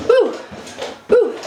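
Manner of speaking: high bright "woo" on each jump; excited whoop. Real, not acted. Coach whooping on each jumping jack, excited. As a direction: whoop it up as you bounce.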